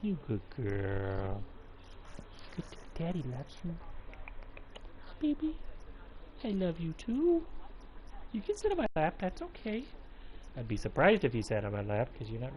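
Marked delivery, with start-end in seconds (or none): 0.0-9.8 high, soft cooing to a pet. Real, not acted. Talking to his cat.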